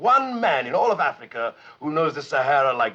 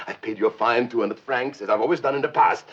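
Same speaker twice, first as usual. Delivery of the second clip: ordinary delivery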